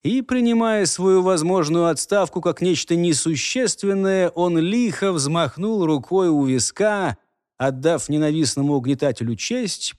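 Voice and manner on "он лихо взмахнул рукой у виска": plain reading voice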